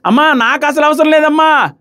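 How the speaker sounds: high, slow whiny mimicry of a sneering woman; mock her changing voice tone to show it irritates; mocking voice imitation. Vlogger mimicking Madhuri dismissing Divya, high mocking whine. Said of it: "ఆ voice టోన్లు మార్చడం ఇవన్నీ irritating".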